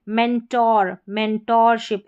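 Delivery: मेंटोर said "men-TOR"; full O sound. Shown as a demonstration. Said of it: "ओ का sound आ रहा है".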